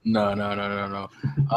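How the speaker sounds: ordinary talk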